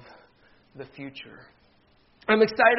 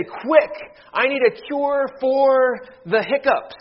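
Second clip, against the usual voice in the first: frantic plea; 7 semitones higher, 5 dB louder, slower than usual. Acted. Joke: frantic plea to pharmacist.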